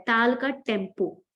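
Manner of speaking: normal talking voice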